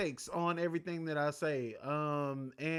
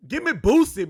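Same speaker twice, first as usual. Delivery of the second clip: hyped call; 5 semitones higher, 11 dB louder than usual. Real, not acted. Hyped request, repeated.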